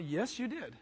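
ordinary delivery

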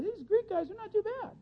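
high-pitched, delighted mock admiration; excited approval. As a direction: play someone naively won over. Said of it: "really getting excited".

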